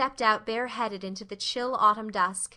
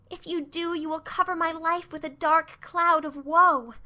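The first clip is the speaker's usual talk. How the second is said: put-on higher, dramatic girlish plea; pleading Anne; 5 semitones higher than usual. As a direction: beg her with big dramatic feeling. Anne pleading with Missus Barry.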